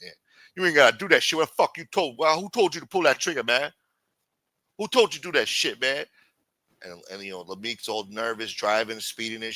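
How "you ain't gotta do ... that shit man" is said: raised, rapid scolding; Hakeem. Retold or imitated. Hakeem demanding who told him to shoot, high agitated scolding. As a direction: demand answers like an exasperated accomplice. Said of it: "Yeah, that's how he talks".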